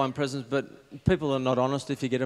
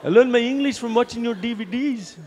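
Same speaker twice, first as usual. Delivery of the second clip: put-on high-pitched, loud, botched Spanish accent; bad Spanish accent; 10 semitones higher, 7 dB louder than usual. Spanish fan of the comic's DVDs, high bad Spanish accent. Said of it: "can't do Spanish accent".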